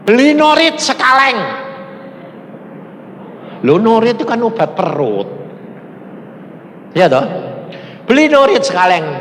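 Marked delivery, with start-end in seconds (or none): 0.0-1.4 loud, high command from God; suara Tuhan. Retold or imitated. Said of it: "Tuhan ngomong gini". 8.1-9.1 high, raised-voice divine order; suara Tuhan. Retold or imitated. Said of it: "Tuhan ngomong gini".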